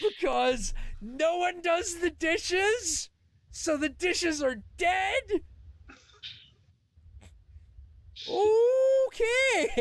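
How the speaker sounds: hammed-up incredulous okay; mock incredulous summary. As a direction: spell out the absurd logic. Mocking roommate's logic.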